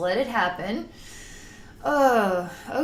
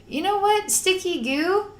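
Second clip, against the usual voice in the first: bright realization; 10 semitones higher than usual. Real, not acted. Coaching the goo, upbeat.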